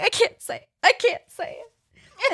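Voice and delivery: high, mock tearful little kid; imitating a crying child. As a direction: whimper it like a small boy refusing. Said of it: "Alex was, like, crying".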